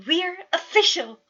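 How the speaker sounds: high, loud, thrilled cry; excited announcement. A narrator's reading. Roman tells the whole room, bursting with excitement. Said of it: "Roman confirmed excitedly".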